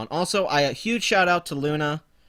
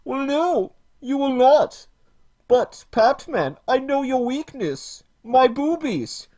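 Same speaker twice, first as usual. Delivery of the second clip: high, loud mocking put-on woman's voice; hammed-up parody of a preachy feminist YouTuber; parody Lacey Green; 8 semitones higher, 4 dB louder, slower than usual. Streamer mocks Lacey Green to chat, high mocking voice. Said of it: "For I am Lacey".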